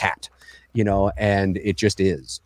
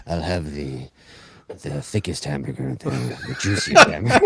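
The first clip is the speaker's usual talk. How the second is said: dramatic doctor voice; 7 semitones lower than usual. Pretend. Host parodying the doctor ordering food, hammed-up grave and solemn. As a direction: order a burger like a doom-laden prophecy. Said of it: "We imagine ordering a hamburger".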